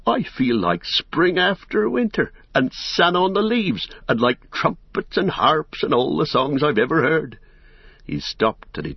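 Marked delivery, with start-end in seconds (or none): 0.1-7.4 high, exuberant outpouring; elated. A narrator's reading. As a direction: let the joy pour out, pitched high. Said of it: "he waved his arms in the air".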